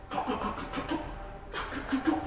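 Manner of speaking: soft, rapid "cuk-cuk" chatter; trembling noises. Acted. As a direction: imitate shivering with quick clicks. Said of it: "dia mulai gemeteran".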